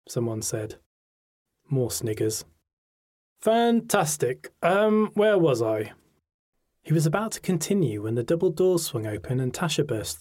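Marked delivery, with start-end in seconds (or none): 3.4-5.9 sarcastic. A narrator's reading. Teacher's dry reply.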